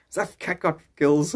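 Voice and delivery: ordinary talk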